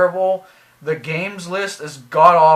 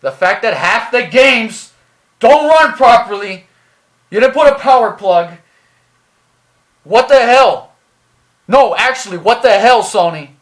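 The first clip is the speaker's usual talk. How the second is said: loud, raised, exasperated outburst; angry rant; 3 semitones higher, 8 dB louder than usual. Real, not acted. Reviewer berating Sony over broken games, angrily shouting. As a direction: yell it in outrage, punching each phrase.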